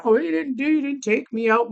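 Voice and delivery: lightly mock her griping; mock complaint. Man-advising vlogger mimics a date griping on Facebook, mock complaint.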